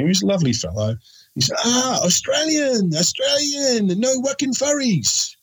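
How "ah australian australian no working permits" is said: high, slow imitation of a Turkish hotelier; imitating the little Turkish man's accent, pitched high. Friend retells the Turkish hotel clerk, high mock accent. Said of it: "the little Turkish man".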